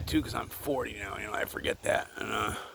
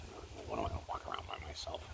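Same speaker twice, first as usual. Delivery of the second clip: breathy whisper, much softer and lower, rushed; whispered aside; 13 semitones lower, 13 dB quieter, faster than usual. Real, not acted. Asking viewers about walking around alone, in a hushed fast whisper.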